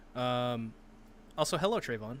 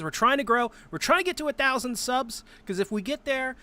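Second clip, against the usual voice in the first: excited; 9 semitones higher than usual. Acted. Excited promo plea.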